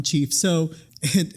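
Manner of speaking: ordinary delivery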